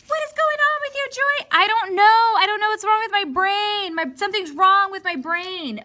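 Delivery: very high, fast squeaky panic; a frazzled little voice that can't think; squeaky muddled self; flustered inner voice. Acted. Inner self answers, confused.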